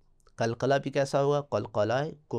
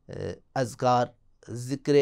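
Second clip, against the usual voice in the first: usual speaking voice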